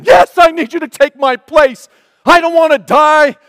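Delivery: high, loud desperate plea; scream. Retold or imitated. A man about to crash screams to Jesus for help. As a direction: cry out begging not to die. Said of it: "And you scream".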